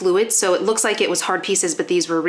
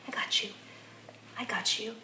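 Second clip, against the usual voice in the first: much softer, murmured comfort to the dog; quietly reassuring the dog while bracing him; soft reassurance; 16 dB quieter than usual. Real, not acted. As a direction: hold him and soothe him under your breath.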